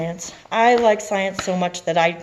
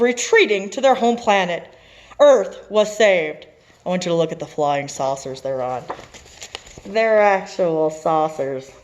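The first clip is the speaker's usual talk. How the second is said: louder, triumphant read-aloud; story narration; 4 semitones higher, 6 dB louder than usual. A narrator's reading. Teacher reading the happy ending, triumphant narration. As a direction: wrap up the tale with a flourish.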